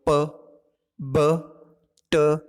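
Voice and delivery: crisp प, ब, ट, each stopped sharply; model consonant sounds. Shown as a demonstration. Teacher sounding out प, ब, ट for the class. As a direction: sound out प, ब, ट one by one. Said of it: "जैसे बोलो".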